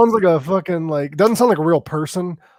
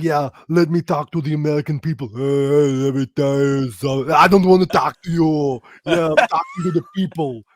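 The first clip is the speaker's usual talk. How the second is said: lightly put-on politician voice; impression of a politician. Mock speech to the American people, lightly voiced. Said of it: "pretty good at impressions".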